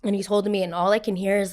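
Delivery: ordinary talk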